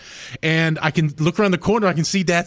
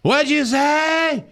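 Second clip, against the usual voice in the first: put-on high, slow, drawn-out question from a deaf old woman; hard-of-hearing mom imitation; 10 semitones higher, much slower than usual. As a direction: stretch it out high, straining to hear. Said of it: "then she goes".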